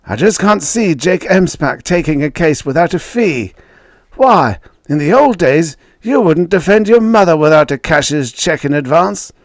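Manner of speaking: high, loud mock disbelief; taunting. A narrator's reading. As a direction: needle an old rival with his reputation. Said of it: "Jake accepted the taunt".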